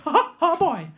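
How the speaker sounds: high cartoon falsetto chuckle; mouse impression. Fan hides behind the mascot, squeaky mouse impression. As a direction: do a squeaky, wholesome mascot laugh. Imitating Mickey behind him.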